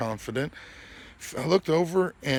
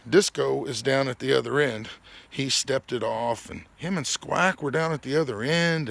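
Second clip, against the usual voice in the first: ordinary talk, nothing marked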